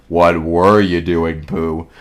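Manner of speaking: slow, very low gloomy donkey; low, slow storybook donkey; Eeyore. A narrator's reading. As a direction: drag it out deep and glum. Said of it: "came Eeyore's voice".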